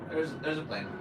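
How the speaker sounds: ordinary talk, nothing marked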